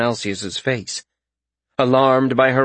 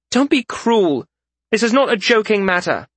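scolding; 10 semitones higher than usual. A narrator's reading. Frisha, hands on hips, scolds Rezkin, high and indignant. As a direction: rebuke him sharply.

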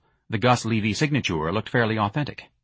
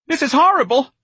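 very high, loud squeal of horror; Mister Gonzalez squeaking; 8 dB louder than usual. A narrator's reading. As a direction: squeal it in alarm. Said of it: "Mister Gonzalez squeaked".

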